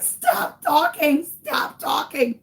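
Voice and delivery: high, slow, tearful pleading; desperate wail. Retold or imitated. Mother begging son to hush, desperate wail. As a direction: wail it out in acted despair.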